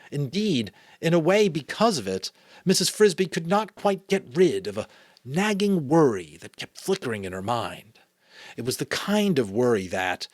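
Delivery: measured read-aloud storytelling; story narration. A narrator's reading. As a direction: read a children's novel aloud.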